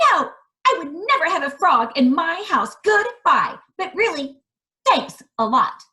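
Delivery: high, fast, loud dismissal; princess refusing. Acted. Princess dismissing the frog at the pond, high and quick. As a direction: brush him off like a spoiled princess.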